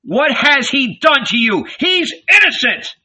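high, loud protest; outraged, rising cry. A narrator's reading. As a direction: plead his case at a shout. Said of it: "he cried".

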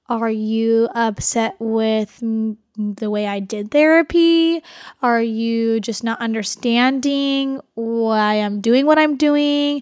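each word drawn out slowly and gently; a therapist carefully checking in, slow and tentative; slow, careful questioning. Retold or imitated. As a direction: ask it slowly, feeling your way. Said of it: "I had to take a step as a therapist to be like".

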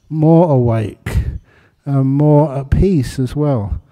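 fervent teaching. Acted. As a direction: build conviction as you teach.

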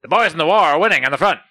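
lightly voiced newsreel announcer; a hint of a wartime newsreel; old newsreel. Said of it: "old-timey, like, newsreel footage".

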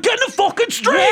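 high-pitched angry order; imitated yell. Man on sidewalk yells at cyclist, imitated yell. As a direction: act out a guy yelling at you. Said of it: "he'd be like".

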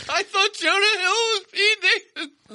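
high, helpless laughing wail; laugh-crying. Real, not acted. Host mocking his own mix-up, helpless laughing wail. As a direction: break down laughing at yourself.

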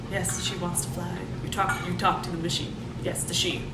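low, soft, hushed movie voice; low quiet character voice. Retold or imitated. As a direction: murmur it low like the film character. Acted movie dialogue.

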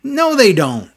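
loud, high, indignant "no"; emphatic no. Real, not acted. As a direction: burst out in exasperated denial. Answering own question with outrage.

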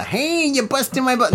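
high-pitched, hammed-up fake New York accent; Joe Pesci-style New York accent. Shown as a demonstration. Host showing off a fake New York accent anyone can do, pitched high. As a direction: lay on a cartoon New York wise-guy accent. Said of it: "that's more Joe Pesci".

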